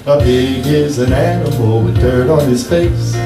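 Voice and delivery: ordinary talk, nothing marked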